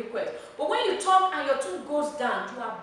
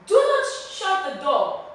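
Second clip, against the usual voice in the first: loud, high, emphatic order; ringing teacher's demo command; 7 semitones higher, 9 dB louder than usual. Shown as a demonstration. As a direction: lay down the rule with full force. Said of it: "It is a command".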